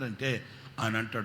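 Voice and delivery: ordinary talk, nothing marked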